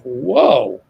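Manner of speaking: normal talking voice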